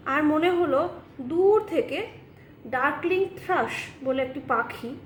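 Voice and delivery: ordinary talk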